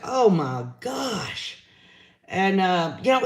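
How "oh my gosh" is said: slow, drawn-out exasperated groan of words; retold exasperation. Commentator reliving the reaction to his tangents, drawn-out exasperation. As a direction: stretch it out in weary disbelief.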